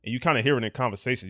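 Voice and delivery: ordinary talk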